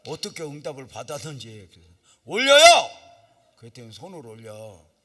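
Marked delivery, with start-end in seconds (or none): 2.2-3.5 sudden loud high shout; shouted command. Retold or imitated. Pastor yelling at the reluctant woman, full shout. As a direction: bark the order to raise hands.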